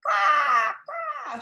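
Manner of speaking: shouted bird caws, jumping high; cawing crow with a bow. A narrator's reading. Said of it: "shouted the crow with a little bow".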